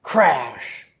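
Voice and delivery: drawn-out, punchy "Crash"; big storybook crash, falling in pitch; dramatic crash. A narrator's reading. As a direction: stretch the word like breaking glass. Second window breaking.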